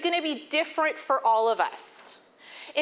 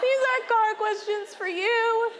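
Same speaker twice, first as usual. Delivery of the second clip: crying harder, imitated; 9 semitones higher than usual. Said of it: "he starts to cry harder".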